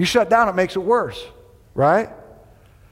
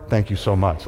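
ordinary delivery

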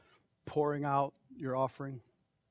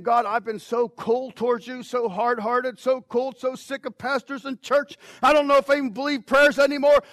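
high, loud shouted confession; loud acted prayer; 7 semitones higher, 9 dB louder than usual. Pastor voicing a cold-hearted churchgoer praying, shouting. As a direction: cry it out as a desperate sinner. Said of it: "Come and saying".

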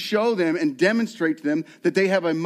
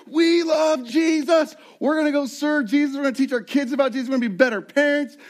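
gushing post-retreat zeal; 8 semitones higher than usual. Retold or imitated. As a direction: gush like someone fresh home from a retreat. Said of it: "we're like".

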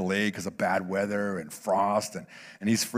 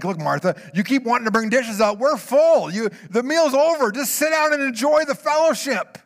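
much higher and louder, urging her to sit; exasperated call; 12 semitones higher, 7 dB louder than usual. Acted. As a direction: call across the room: we're full. Jesus voiced telling Martha sit.

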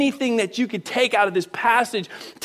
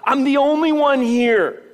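raised, drawn-out protest; insistent Elijah; 6 semitones higher, 5 dB louder, slower than usual. Acted. Elijah complaining to God, insistent. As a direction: plead your case, higher and slower.

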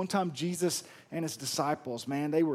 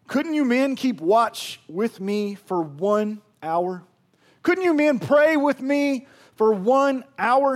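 pleading rebuke; 7 semitones higher, 10 dB louder, slower than usual. Retold or imitated. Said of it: "Watch what Jesus says".

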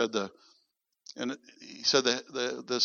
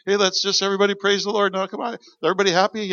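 high, loud, forced cheerful pep; mock a leader hyping the crowd while hurting inside; fake-happy worship leader; 7 semitones higher, 6 dB louder, faster than usual. Leader hiding his hurt, pumping up the church with fake cheer. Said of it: "we can act like, you know, we're fine and happy".

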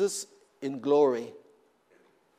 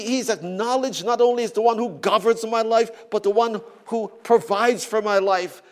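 raised, loud preaching voice; fervent preaching; 5 semitones higher, 6 dB louder than usual. Acted. Preacher proclaiming God to his congregation, fervent and loud. Preacher building to full force.

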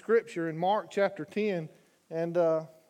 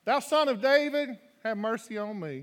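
higher, louder plea; crying out louder; 5 semitones higher, 3 dB louder than usual. Acted. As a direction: shout for mercy over the crowd. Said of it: "he cried the more a great deal".

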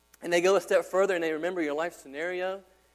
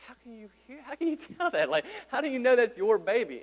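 higher, incredulous questions with a laugh; amazed; 8 semitones higher, 7 dB louder than usual. Real, not acted. Preacher marvels at moms hearing their baby's cry, amazed and higher. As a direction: marvel at how moms can tell.

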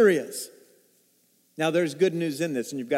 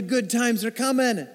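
normal talking voice

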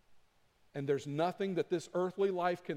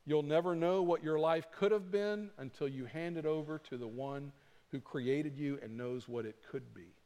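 normal talking voice

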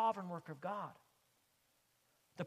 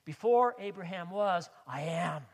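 repeated louder; 9 dB louder than usual. Acted. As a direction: proclaim Before with force. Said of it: "He says to them".

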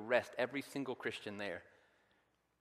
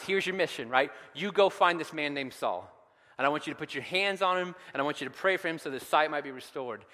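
preaching build; 3 semitones higher, 5 dB louder than usual. Acted. God voiced commissioning Ananias.

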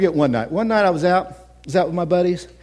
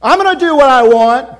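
defiant sinner; 8 semitones higher, 7 dB louder than usual. Retold or imitated. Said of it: "stood with our sign and said".

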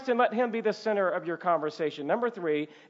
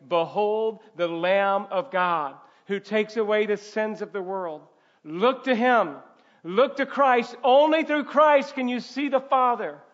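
loud, rising gospel proclamation; impassioned preaching; 4 semitones higher, 7 dB louder than usual. Acted. Preacher calling congregation to look to Christ, impassioned and loud. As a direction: build into a higher, forceful appeal.

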